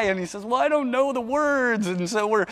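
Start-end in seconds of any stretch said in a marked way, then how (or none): 0.4-1.9 put-on higher childlike whine; worried little boy. A hint of a worried preschooler explaining to his parents. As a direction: sound like a small boy near tears.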